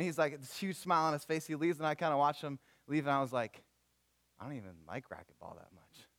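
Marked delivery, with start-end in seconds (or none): 4.4-6.1 low, much softer private admission; muttered aside. Retold or imitated. Pastor admitting to himself once the guy has left, muttered aside. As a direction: drop it to a deflated mumble.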